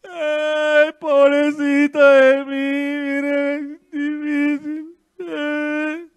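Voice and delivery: lightly whined, slow self-pity; mock self-pity whine. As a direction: parody a complainer feeling sorry for herself. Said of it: "se tiene que acabar la queja". (imitación burlona y quejumbrosa)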